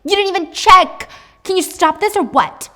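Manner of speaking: loud, fast, rising into a furious yell; panicked screaming. Acted. Eve screams at the corrupt police chief on the phone, panicked rage. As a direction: scream at him, losing control. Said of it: "And she's screaming".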